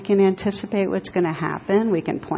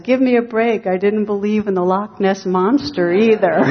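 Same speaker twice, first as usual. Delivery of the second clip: exasperated protest; 3 semitones higher, 6 dB louder than usual. Acted. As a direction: plead your case loudly, playing up the punchline. Atheist protests back in a joke.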